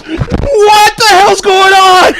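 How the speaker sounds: high, loud, slow baffled cry; baffled outburst. Retold or imitated. Watching the pretend phone game, high slow baffled outburst. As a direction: stretch it out in disbelief. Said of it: "And I was like".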